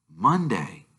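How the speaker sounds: ordinary delivery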